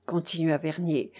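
ordinary delivery

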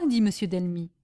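usual speaking voice

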